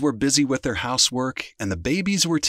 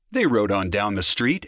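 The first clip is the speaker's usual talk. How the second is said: plain reading voice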